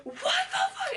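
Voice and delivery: very high-pitched mock shriek; outraged shriek. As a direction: squeal it out as if just tased. Retells fury after being tased.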